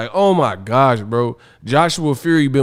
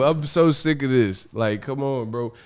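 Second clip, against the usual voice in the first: usual speaking voice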